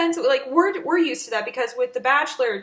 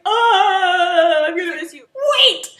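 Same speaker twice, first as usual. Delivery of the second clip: mock tearful goodbye; 6 semitones higher, 6 dB louder, slower than usual. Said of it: "she's like".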